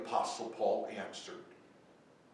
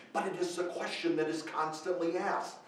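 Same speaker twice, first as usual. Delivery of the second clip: ordinary talk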